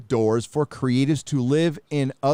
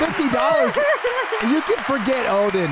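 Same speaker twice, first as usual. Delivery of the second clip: hype announcer call; 12 semitones higher than usual. Acted. Calling out donation amount.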